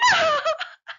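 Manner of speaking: high, helpless wheeze of laughter; wheezing laugh. Real, not acted. Fan cracking up at a flirty line, wheezing laughter. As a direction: laugh until you wheeze. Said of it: "actually wheezing".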